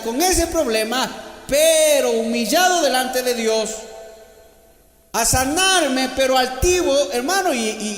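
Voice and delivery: loud, high, fervent sermon delivery; preaching at full force. Acted. Preacher shouting Paul's resolve to the church. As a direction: thunder it out to the congregation.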